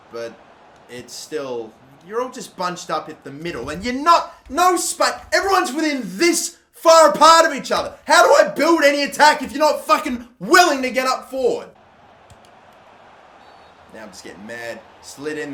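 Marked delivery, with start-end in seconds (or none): 3.7-11.7 high, loud exasperated yelling; angry rant. Real, not acted. Gamer ranting at his bunched-up team, angry yelling. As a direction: scold the players for not moving up.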